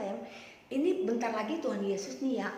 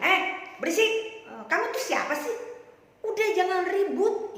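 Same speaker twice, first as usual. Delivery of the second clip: higher-pitched annoyed crowd; scolding; 7 semitones higher, 3 dB louder than usual. Acted. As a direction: tell off a noisy nuisance. Crowd scolds the shouting beggar. (memarahi dengan nada tinggi)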